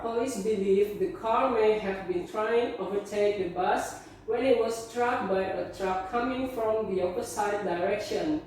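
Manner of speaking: usual speaking voice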